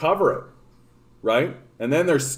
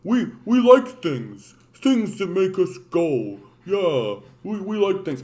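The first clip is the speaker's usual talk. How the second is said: slow, higher, dopey alien speech; dim alien voice; 5 semitones higher, slower than usual. Retold or imitated. Dim Star Trek aliens begging for help, slow and dopey. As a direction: talk slow like a simple-minded alien. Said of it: "And they talk like this".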